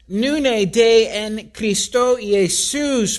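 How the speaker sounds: "Nune de en Christo Jesus" read slowly in Koine Greek, each word distinct. Shown as a demonstration. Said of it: "the emphasis in Greek is on the word now".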